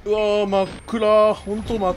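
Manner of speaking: drawn-out startled cry; slow loud dismayed wail. Real, not acted. Startled by darkness.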